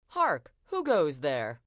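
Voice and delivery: loud prince calling through a door. A narrator's reading. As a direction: call out through a heavy door. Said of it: "the prince called through the door".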